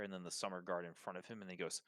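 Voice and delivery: usual speaking voice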